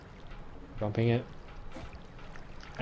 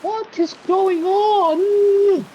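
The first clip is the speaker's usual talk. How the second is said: very high, slow drawn-out wail; bewildered cry; 5 dB louder, much slower than usual. Real, not acted. Gamer baffled by the game, very high slow cry. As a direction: stretch it out in disbelief.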